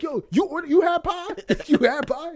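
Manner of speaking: high, pointed accusing questions. Retold or imitated. Dad staring down family over pie, accusing high voice. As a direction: interrogate each family member. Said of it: "looking all of us in the eye".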